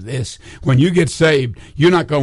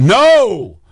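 raised quick no, only half replayed; mild retold yell; 5 semitones higher, 4 dB louder than usual. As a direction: echo the scream lightly. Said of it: "I'd already screamed".

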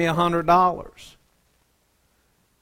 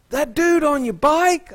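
lazy airhead surfer drawl pitched up; high, slow surfer voice; 12 semitones higher, 3 dB louder than usual. Retold or imitated. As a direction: friend asking about the bike in a high airhead drawl. Friend asks about rider.

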